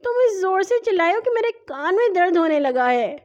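put-on high, whiny child's complaint; sulky high little-boy voice; pouting whine. A narrator's reading. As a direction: whine with a pout. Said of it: "منہ بسورتے ہوئے بولا".

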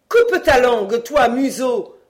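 stern scolding. A narrator's reading. Mother silencing her son, severe and loud. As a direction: cut the boy off hard. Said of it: "me dit-elle sévèrement".

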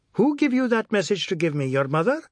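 high-pitched accusing question; angry challenge. A narrator's reading. Husband challenging wife over a message, angry challenge. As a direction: demand to know who sent her.

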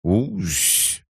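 high little warning hiss; quiet threatening hiss. A narrator's reading. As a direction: hiss a warning like a small creature. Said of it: "тихо, угрожающе прошипела кукла". (тихое угрожающее шипение)

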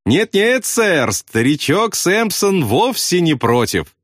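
high, slow, gleeful call; happy eagerness on a high stretched voice; joyful yell. A narrator's reading. Pilot happily obeys bombardier, joyful high yell. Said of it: "радостно заорал он".

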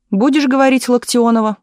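insistent demand. A narrator's reading. City secretary repeats his order to the accused, insistently. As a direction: press her for an answer. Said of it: "повторил секретарь горкома".

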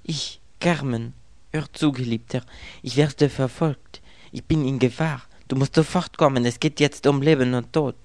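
hunted Carmen pleading over the phone; frightened woman. A narrator's reading. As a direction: beg for help in a panic.

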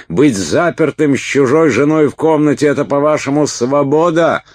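high, loud indignant protest; outraged shouting. A narrator's reading. Уилт locked in with another's wife, outraged shout. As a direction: shout like someone trapped and furious. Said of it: "закричал Уилт, пытаясь открыть дверь".

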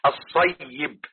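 الصيب drawn out as "as-say-yib"; correct pronunciation. Shown as a demonstration. Teacher repeating the right pronunciation for students, carefully articulated. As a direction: hold the doubled ya in الصيب, "as-sayyib". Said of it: "النطق الصحيح".

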